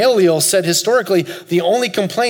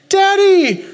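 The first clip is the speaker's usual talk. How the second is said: put-on much higher and louder, joyful little-boy cry; excited toddler; 6 dB louder than usual. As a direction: call out like a thrilled small child. Dreamed toddler runs to dad.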